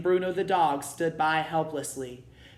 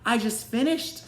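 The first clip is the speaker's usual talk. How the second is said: high, louder protest; Cinderella crying out; 10 semitones higher, 6 dB louder than usual. A narrator's reading. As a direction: plead that you're already done. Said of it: "Cinderella cried".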